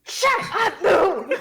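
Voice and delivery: high-pitched, full-voiced scolding shout; exasperated yell. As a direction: yell across the room to silence someone. Yells back at shouting Moo.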